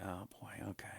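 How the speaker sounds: breathless, unvoiced whisper. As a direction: whisper it like you're caught out. Host reacting under breath.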